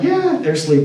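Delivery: high-pitched, carefree retold reply; breezy dismissal. Telling the date it is safe to stay, breezy dismissal. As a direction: brush the worry off, pitch jumping up. Waving away his worry, retold.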